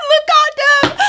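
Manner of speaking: loud, high laughing cry; laughing shout. Real, not acted. Telling viewers how dumb she looked, loud laughing shout. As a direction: shout it through helpless laughter.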